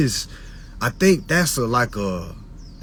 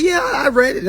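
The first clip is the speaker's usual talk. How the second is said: high, breezy mock client; confident client; 12 semitones higher than usual. Client breezily claiming he read it. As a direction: play a careless client. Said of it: "a lot of folks say".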